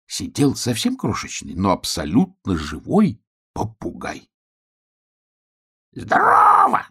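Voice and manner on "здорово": very high, loud parrot squawk; parrot's cry. Acted. Tiny parrot greets hedgehog, squawking. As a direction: squawk a piercingly high hello. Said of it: "крикнул попугай".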